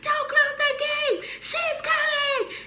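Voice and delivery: very high, slow, drawn-out shout; frantic friends yelling. Retold or imitated. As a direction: holler across a field to stop someone.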